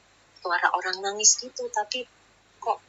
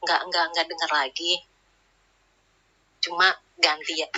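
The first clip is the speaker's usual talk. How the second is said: ordinary talk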